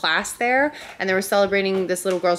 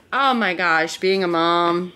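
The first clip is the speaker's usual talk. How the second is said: normal talking voice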